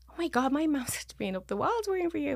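retold scolding, pitched high; giving out. Leanne scolding him for worrying her mum, exasperated. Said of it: "Leanne gave out to him".

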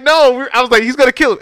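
high, loud mock-horrified "no"; retold alarm. Viewer dreading the killing on screen, retold loud alarm.

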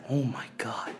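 breathy whispered oh my God. Retold or imitated. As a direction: whisper it in disbelief. Whispered reaction again.

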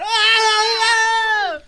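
long, high, loud mock scream; imitated scream. Imitating the passenger dragged off the plane, screaming. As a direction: wail like the man being dragged. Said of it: "que gritaba el señor".

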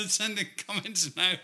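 high, laughing through the words. Real, not acted. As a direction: tease while giggling. Laughing while teasing about comments.

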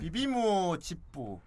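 put-on slow Korean with a light Japanese accent; lightly put-on Japanese accent, each syllable drawn out. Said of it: "일본인들은 발음하기 힘들지 않을까?".

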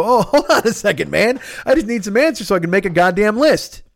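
high, strained protest; high defensive whine. Retold or imitated. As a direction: plead your case, voice up high. Pleading back to friend, high.

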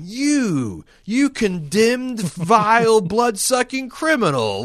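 high, slow, sneering accusation; hostile Chekhov. Retold or imitated. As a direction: spit out each insult in a high voice. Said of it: "right off the bat going".

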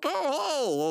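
high drawn-out hey; mock greeting. Gang greeting brother out of the car, high mock welcome. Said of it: "they're like".

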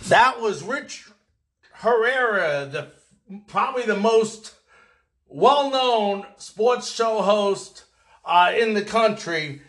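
bright, lifted host outro; show sign-off. Acted. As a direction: wrap up the show for listeners.

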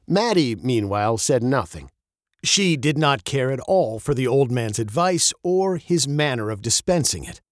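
usual speaking voice

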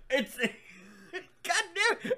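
high, breaking into laughter mid-curse; laughing hard. Real, not acted. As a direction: laugh through the curse, can't hold it. Laughing at own joke.

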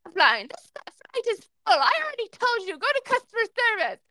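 a hammed-up rude gate agent barking orders, well up in pitch; mock angry yell. Gate agent retold yelling at Jen to leave, mock yell. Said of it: "he just started yelling".

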